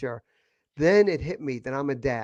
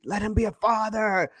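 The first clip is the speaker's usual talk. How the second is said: higher mock yell from townsfolk; 5 semitones higher, 4 dB louder than usual. Townspeople yelling at the mother, higher mock yell. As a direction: shout it like the street crowd. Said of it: "yelling at the mother on the street".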